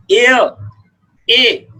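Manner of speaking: एयर said with no h, opening on a clear ay; sounding out heir. Shown as a demonstration. Teacher to class, saying एयर with a silent h. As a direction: open एयर on ay with the h dropped. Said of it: "हेयर नहीं है".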